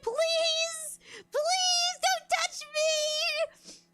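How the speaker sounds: high, slow, whiny mock plea; mock pleading. Pleading with germy younger cousins, mock whiny plea. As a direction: beg them to keep away, hamming it up.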